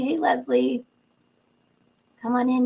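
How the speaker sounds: usual speaking voice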